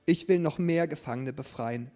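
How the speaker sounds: loud, commanding royal call; booming warrior king. Acted. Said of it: "rief der Königssohn gerade mit lauter Stimme". (laut rufender Königssohn)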